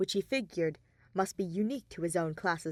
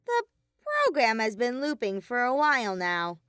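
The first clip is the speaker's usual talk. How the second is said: Monokuma voice; 5 semitones higher, 5 dB louder than usual. A narrator's reading.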